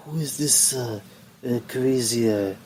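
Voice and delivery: mock Japanese accent, lightly put on; a clumsy Japanese-accented Asuka, puzzled; a hint of a Japanese accent, half-hearted; bad Japanese accent. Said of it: "bad Japanese accent".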